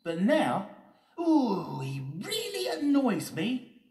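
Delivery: very high, loud character voice; Steady Eddie, annoyed. Acted.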